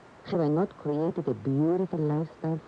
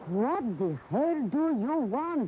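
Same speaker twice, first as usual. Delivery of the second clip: slow, pitched-up, exasperated demand; 7 semitones higher, slower than usual. Acted. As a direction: drag it out, fed up with him. Wife's exasperated outburst in quarrel.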